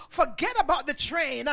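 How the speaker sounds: usual speaking voice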